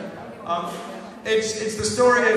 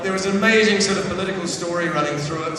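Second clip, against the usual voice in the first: normal talking voice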